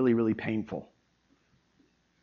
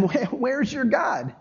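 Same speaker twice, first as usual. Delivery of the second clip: taunting enemies; 8 semitones higher, 4 dB louder than usual. Retold or imitated. Enemies taunting the psalmist, mocking and shouting. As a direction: taunt him as a sneering enemy. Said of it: "They're saying to him".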